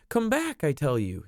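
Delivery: high insistent cry; child crying out. Acted. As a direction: stamp and demand, pitched high. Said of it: "he cried".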